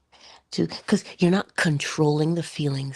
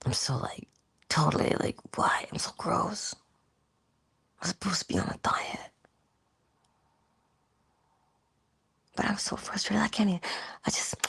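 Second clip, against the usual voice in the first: whispered and much softer, disgusted with yourself; self-loathing whisper; 3 semitones higher, 6 dB quieter than usual. Pretend. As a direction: mutter your guilt under your breath while eating. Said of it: "with the attitude of".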